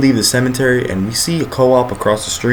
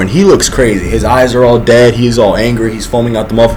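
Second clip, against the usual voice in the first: usual speaking voice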